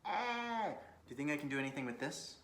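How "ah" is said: surprised. Real, not acted. Reacts to backless shirt.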